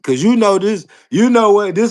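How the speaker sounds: excited call to the chat; loud outburst. Real, not acted. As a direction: raise your voice to grab the room.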